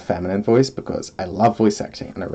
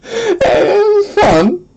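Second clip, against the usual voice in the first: laughing hard; 6 dB louder, slower than usual. Real, not acted. Laughing after the attempts.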